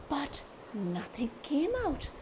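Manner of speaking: whispered, no voice. A narrator's reading. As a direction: breathe the words out voicelessly. Voice lost, whispered.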